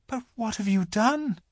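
high-pitched, puzzled Lucy; questioning little girl. A narrator's reading. Lucy asking the faun what he has done, puzzled. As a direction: ask in a high girl's voice.

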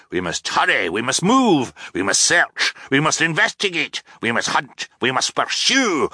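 rousing captain's tirade, swinging up in pitch; urgent blustering captain. A narrator's reading. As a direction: rattle off orders with mounting urgency. Captain's urgent list in story.

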